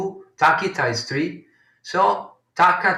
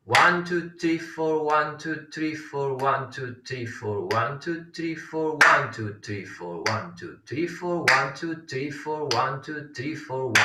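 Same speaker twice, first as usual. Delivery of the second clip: continuous rhythmic count in fours; running subdivisions, steady as a metronome; chatushra gati count; 4 dB quieter than usual. Shown as a demonstration. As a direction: count evenly without a break, landing on one. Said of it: "usually in Chatushra Gati".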